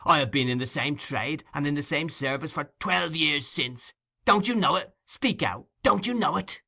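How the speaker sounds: high, loud, scornful fury; furious tirade. Acted. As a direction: rage at him without holding back. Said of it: "whose passion was frightful".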